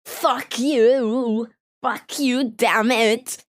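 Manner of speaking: loud mock swearing. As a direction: yell it in fake anger. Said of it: "swear, okay".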